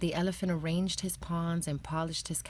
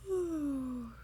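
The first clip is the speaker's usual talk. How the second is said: high sleepy ooh; 11 semitones higher than usual. Acted. Amos yawns.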